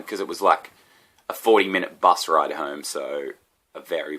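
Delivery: ordinary talk, nothing marked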